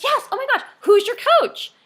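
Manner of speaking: very high and loud, bubbling over; excited gush. Shown as a demonstration. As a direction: gush with delight and ask eagerly. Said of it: "It's like".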